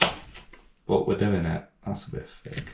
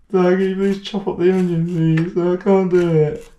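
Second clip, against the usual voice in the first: high, louder whiny plea of "Dad, Dad"; pleading child; 10 semitones higher, 4 dB louder than usual. Pretend. Scene: son begging his dad to chop the onion, high whiny plea. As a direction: beg like a helpless kid, pitch way up.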